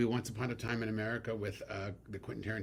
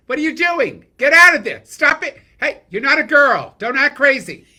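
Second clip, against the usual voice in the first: screeching, very high and very loud mom yell; mother yelling; 21 dB louder than usual. Retold or imitated. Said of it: "My mother would be like this".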